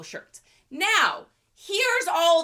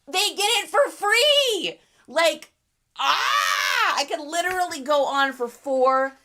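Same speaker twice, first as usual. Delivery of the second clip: louder, slower, heated outburst; blowing off steam about free donations; worked up rant; 3 semitones higher, 7 dB louder than usual. Real, not acted. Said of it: "I get worked up".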